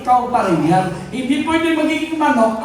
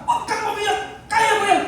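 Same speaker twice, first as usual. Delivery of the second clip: laughing, brash, higher "Huwag kang umiyak"; tough-love pep talk; 7 semitones higher than usual. Shown as a demonstration. Pastor showing his usual blunt way with his kids, brash and laughing. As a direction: toss it off like a tough dad who won't coddle. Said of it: "ganun lang ako eh".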